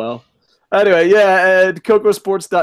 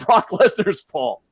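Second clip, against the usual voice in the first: usual speaking voice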